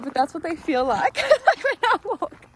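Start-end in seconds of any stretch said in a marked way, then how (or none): 1.2-2.5 laughing loud and high through the words. Real, not acted. Friend calls the borrowed shoes clown shoes, laughing. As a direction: crack up while admitting it.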